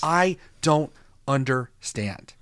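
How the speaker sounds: slow, word-by-word bafflement; baffled emphasis. Real, not acted. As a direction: space out every word in disbelief. Baffled end of a questioning rant.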